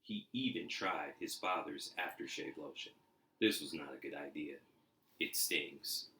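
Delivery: lively storybook reading; animated read-aloud for kids; story narration. A narrator's reading. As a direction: read it like a bedtime picture book. Reading picture book aloud.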